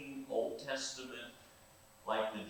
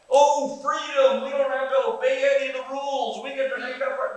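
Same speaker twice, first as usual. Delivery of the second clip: mock celebration; 15 dB louder than usual. Preacher mocks people who think rules are gone, high loud sarcasm. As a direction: ham up a naive rule-breaker's joy. Mocking a misreading of Jesus.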